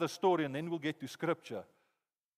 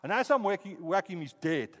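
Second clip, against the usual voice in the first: ordinary talk, nothing marked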